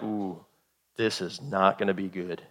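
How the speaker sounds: low, soft uneasy murmur; hushed dread. Acted. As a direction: say it under your breath, sensing trouble. Sailors spot fin, dread it.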